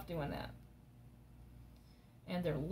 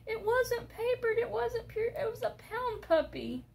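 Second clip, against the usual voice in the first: much higher, pleading the case for the cheap puppy; pleading with hubby; 14 semitones higher, 3 dB louder than usual. Retold or imitated. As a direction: sell it to him in a high pleading voice. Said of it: "I'm like".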